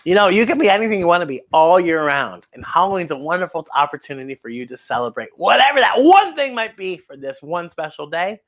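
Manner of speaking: ordinary delivery, nothing marked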